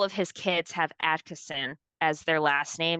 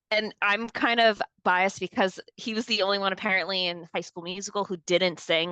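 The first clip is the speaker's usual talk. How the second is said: ordinary delivery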